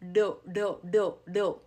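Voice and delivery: do, a light tongue tap; tap sound demo. Shown as a demonstration. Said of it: "For that do sound".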